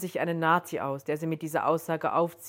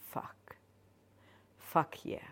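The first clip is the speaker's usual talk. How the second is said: breathless whisper, no voice at all; 10 dB quieter than usual. Acted. Joan, aroused by the stranger's presence, whispers to herself. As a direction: let it escape as a hushed whisper. Joan's aroused inner reaction.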